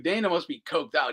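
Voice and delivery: ordinary talk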